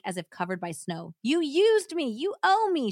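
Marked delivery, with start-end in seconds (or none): 1.2-2.9 high, loud screaming protest. Acted. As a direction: scream it at him. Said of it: "she protested".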